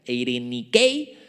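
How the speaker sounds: eirenikei, lento y fuerte, con acento en la E; demostración de pronunciación. Shown as a demonstration. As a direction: pronuncia eirenikei despacio, marcando la E y la K. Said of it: "con acento en la E y con K". (eirenikei pronunciado lento, con acento en la E)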